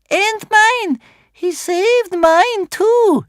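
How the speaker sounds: put-on very high girl's shout. A narrator's reading. Elder sister backs Bear Cub, shouting. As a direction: shout it out high, stretching the end. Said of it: "shouted his elder sister".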